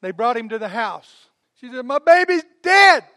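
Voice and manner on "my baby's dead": high, loud, slow wail of grief; mock wailing mother. Mother wails over her dead son. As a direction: cry it out like a grieving mother. Mother voiced, wrong version of story.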